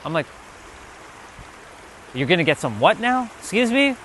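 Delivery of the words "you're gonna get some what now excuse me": softly incredulous, pitch leaping up on the last question; mock confusion, quiet and rising high; baffled questioning. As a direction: act out not believing what you heard. Acting baffled reply to uncle.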